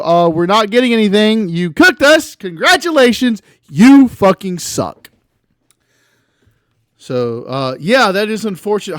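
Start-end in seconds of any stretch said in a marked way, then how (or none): 0.0-5.1 loud, scornful telling off; angry rant. Real, not acted. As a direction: chew out the thieves. Angry at art thieves.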